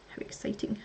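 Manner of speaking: whispered dry sarcasm; sarcastic. Real, not acted. Vlogger sorting parts, whispered mock excitement. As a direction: breathe it out, mock thrilled. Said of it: "sarcastic whisper".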